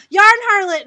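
high, loud fangirl holler; re-enacted excited shout; retold yell. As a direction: call it out like spotting a celebrity. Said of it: "just would yell".

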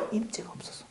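hushed whisper; whispered reply; whispered answer. Acted. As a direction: let the answer drop to a whisper. Answering own question in a hush.

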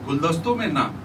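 projected stage recital with long pauses between phrases; poetry recital. Acted. Poet presenting his verse to an open-mic audience, declaimed recital. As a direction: declaim each line, letting it land.

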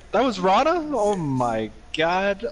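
high question, then a slow, drawn-out exclamation; retold disbelief. Retelling learning it was the friend's wife yelling, incredulous. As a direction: act out stunned disbelief, stretching the exclamation.

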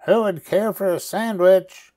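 high calling voice carried from far off; faint call from afar. A narrator's reading. Unseen witch calling to scouts from afar. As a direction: call out across the beach. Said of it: "The voice called out".

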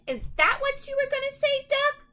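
high, loud teasing; playfully finishing his line; cheerful Goose. Acted. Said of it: "honked Goose".

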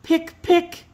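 high, tiny "pick, pick"; chick pecking sound. A narrator's reading. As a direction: peep it like a chick pecking out of its shell. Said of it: "she heard something go".

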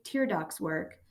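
ordinary delivery

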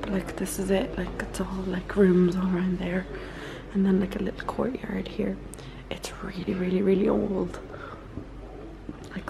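hushed whisper so others sleep. Real, not acted. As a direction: whisper softly at night. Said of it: "I'm kind of whispering 'cause obviously people are asleep".

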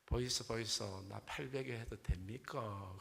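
slow Gyeongsang dialect coaxing; Gyeongsang accent bride. Acted. Gyeongsang bride asks for an arm pillow, Gyeongsang accent. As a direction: ask in Gyeongsang dialect, stretching the ending. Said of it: "경상도 신부도".

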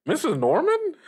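high, astonished recognition; surprised. Acted. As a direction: gasp out the name, stunned. Host recognizes his old teacher.

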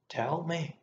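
urgent, fierce order. A narrator's reading. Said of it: "caught my arm the more fiercely".

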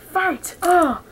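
high call of fight, then excited; booming fighting game announcer. Pretend. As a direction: announce the bout like an arcade game.

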